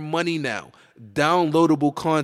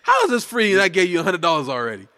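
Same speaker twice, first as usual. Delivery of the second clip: fast, loud incredulous laughing rant; 7 semitones higher, 4 dB louder than usual. Real, not acted. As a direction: laugh in disbelief. Laughing outrage at paying.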